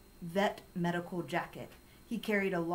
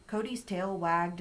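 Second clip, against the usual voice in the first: usual speaking voice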